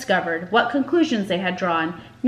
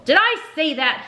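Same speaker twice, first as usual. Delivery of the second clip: sharp, high snap of rage; barked; 7 semitones higher than usual. A narrator's reading. Teacher in a rage barking at timid Mucius. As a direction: bark it at the boy. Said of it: "he barked".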